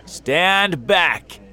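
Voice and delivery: loud, urgent firefighter's order; shouted warning. A narrator's reading. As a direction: shout to clear the crowd. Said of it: "shouted the most important firefighter".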